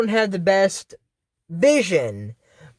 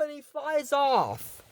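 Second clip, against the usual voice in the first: ordinary talk, nothing marked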